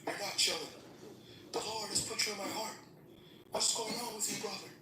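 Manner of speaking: high-pitched, insistent caller. Retold or imitated. Friend [name] insists on phone.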